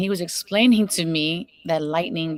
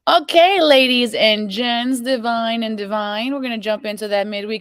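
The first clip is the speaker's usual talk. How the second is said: host announcement; 4 semitones higher, 5 dB louder than usual. Acted. Host turns to viewers to open the segment, announcer style. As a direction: call the audience in like a host.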